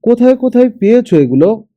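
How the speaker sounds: fast, high astonished shouting; excited shout. A narrator's reading. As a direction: blurt it out in amazement. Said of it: "চেঁচিয়ে উঠল মুসা".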